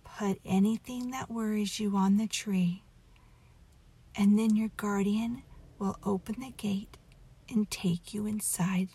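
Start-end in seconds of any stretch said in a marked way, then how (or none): none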